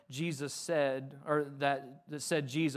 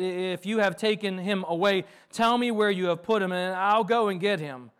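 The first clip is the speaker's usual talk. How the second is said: higher, louder urgent plea; pressing appeal, voice raised; pleading; 5 semitones higher, 7 dB louder than usual. A narrator's reading. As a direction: beg him to tell you. Reads Mary's plea to the gardener.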